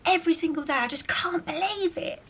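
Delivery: put-on breathy awestruck little boy gushing; imitating little brother. Sister mimics brother Artie gushing over the baby, whispered. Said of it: "he talks like this".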